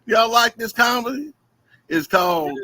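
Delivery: normal talking voice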